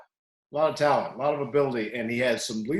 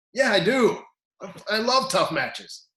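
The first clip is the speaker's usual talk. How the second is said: higher-pitched, dawning conviction; won-over athlete; 7 semitones higher, 4 dB louder than usual. Retold or imitated. Athlete answering the coach's pep talk, newly convinced. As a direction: imitate someone talked into loving it.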